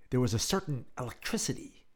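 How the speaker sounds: plain reading voice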